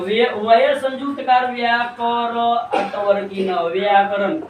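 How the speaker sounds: piece-by-piece spelling of व्याकरण, slowing toward the end; हिज्जे करके उच्चारण. Shown as a demonstration. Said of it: "इसको क्या कैसे करेंगे". (हिज्जे जोड़कर पूरा व्याकरण)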